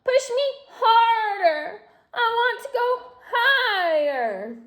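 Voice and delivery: high, slow, greedy doll voice; demanding doll. A narrator's reading. Doll on the swing.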